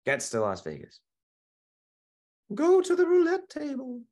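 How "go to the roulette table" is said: a small ghostly voice inside his head; tiny inner voice. Acted. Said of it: "little voice in the back of his head".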